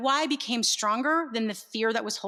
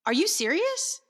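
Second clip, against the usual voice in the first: incredulous; 7 semitones higher than usual. Retold or imitated. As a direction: ask it in disbelief, pitch up. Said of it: "he stops and he goes".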